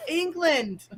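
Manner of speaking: high put-on English accent; fake English accent. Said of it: "this whole bullshit accent".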